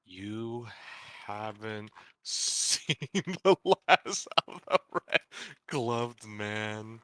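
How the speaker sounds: dragged-out villain threat; slow dramatic drawl. Retold or imitated. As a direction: drag each word out slowly. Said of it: "incredibly slow-talking hot dog vendor".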